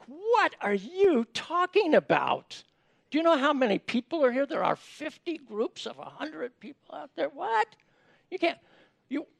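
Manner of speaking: exasperated, can't-believe-it protest; incredulous disciple. Acted. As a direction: roll your eyes and ask if he's serious. Disciple rolling his eyes at Jesus.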